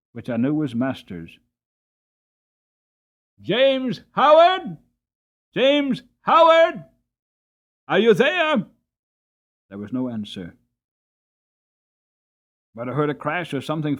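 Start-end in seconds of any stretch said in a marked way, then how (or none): 3.4-8.7 much higher and louder, drawn-out slow call; loud clear call. A narrator's reading. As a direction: bellow the name into the burning stable. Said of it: "loud, clear voice".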